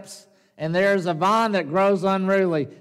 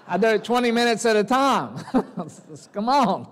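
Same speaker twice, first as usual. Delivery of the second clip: laughing; 6 semitones higher, faster than usual. Real, not acted. Joke about preaching long.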